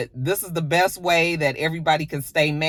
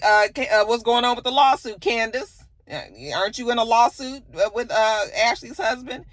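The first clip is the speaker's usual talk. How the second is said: higher, halting questioning, lightly acted; mock nosy prodding; 7 semitones higher than usual. Mia asking Candace about the lawsuit, mock prodding. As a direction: imitate a friend fishing with hesitant questions.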